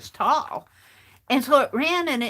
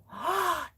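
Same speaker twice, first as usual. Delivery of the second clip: gasp; 6 semitones higher, 6 dB quieter than usual. Retold or imitated. Discovering the gnome on Bandit.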